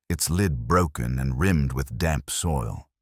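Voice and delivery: usual speaking voice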